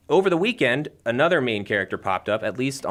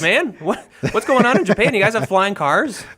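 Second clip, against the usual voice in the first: mock incredulous; 10 semitones higher, 4 dB louder than usual. Teasing a chat commenter about Japan.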